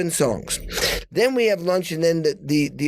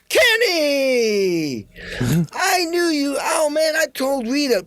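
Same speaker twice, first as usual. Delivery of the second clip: much higher, thrilled greeting; excited Tom Hanks; 9 semitones higher, slower than usual. Retold or imitated. Tom Hanks greeting Kenny at the party, high and thrilled. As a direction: gush with joy at seeing him. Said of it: "he goes".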